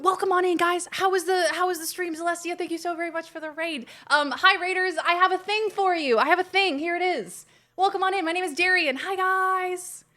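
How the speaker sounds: high, sing-song host greeting; excited welcome. Real, not acted. Streamer introducing themself to raiders, high and eager. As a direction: pitch it right up to welcome the newcomers.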